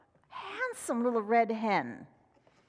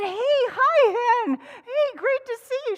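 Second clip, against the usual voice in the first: much higher, louder fox; 12 semitones higher, 9 dB louder, slower than usual. Acted. Fox greeting the hen, high and loud. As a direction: greet her with a bright yelp.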